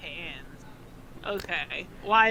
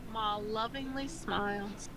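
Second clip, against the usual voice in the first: ordinary talk, nothing marked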